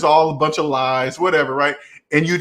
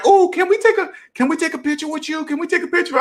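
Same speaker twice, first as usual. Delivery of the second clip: very high mock-girly squeal; excited girls imitation; 13 semitones higher, faster than usual. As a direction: mimic gushing girls in a quick falsetto. Girls asking him for a photo.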